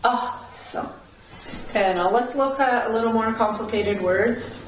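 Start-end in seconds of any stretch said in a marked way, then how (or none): 0.0-0.9 "Awesome" with stress on the opening A; confirming "Awesome", first syllable strongest; upstress on first syllable. Shown as a demonstration. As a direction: stress the A of "Awesome", ending soft and dropped. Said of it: "would go on the A".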